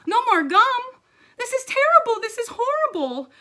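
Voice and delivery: put-on very high, loud kid; hammed-up childish despair; horrified outburst. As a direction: wail in melodramatic disbelief. Gabby laments the ban.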